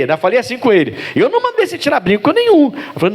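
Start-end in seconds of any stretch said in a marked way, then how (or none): 1.1-2.7 higher, insistent denial; high pitched "I never told you"; surprised protest. Retold or imitated. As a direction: defend yourself, caught off guard. Pastor retells protesting to him.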